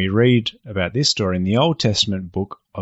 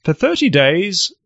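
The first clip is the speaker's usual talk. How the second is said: royal proclamation; 7 semitones higher than usual. Acted. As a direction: proclaim the law to the crowd. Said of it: "The law was read out".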